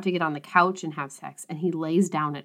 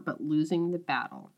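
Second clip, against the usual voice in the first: ordinary delivery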